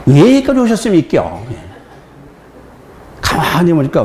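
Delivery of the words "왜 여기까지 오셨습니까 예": high Gyeongsang question through a laugh, falling on 여기까지; 웃으며 경상도 억양으로; Gyeongsang accent. Retold or imitated. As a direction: repeat the Gyeongsang line while chuckling. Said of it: "경상도 어투가 근데 처음 접했기".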